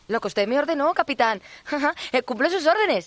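fawning pirate sidekick voice; bumbling sidekick. Acted. First mate, giggling.

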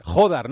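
"Jodar" with a loud, punched first syllable, JO; stress on the O. Shown as a demonstration. Host checks the player's surname with him, "Jodar" stressed on the O. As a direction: hit the JO in "Jodar" hard, let the rest fall. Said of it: "Con acento en la O".

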